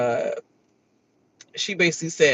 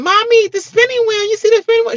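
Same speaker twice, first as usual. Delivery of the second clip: put-on much higher, louder child voice; imitating son; 6 dB louder than usual. Son calling out to mommy, high child voice. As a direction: pipe it up like an excited kid. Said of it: "my son saying".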